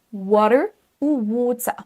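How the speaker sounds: American then British water; English final R. Shown as a demonstration. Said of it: "le R final ne se prononce pas".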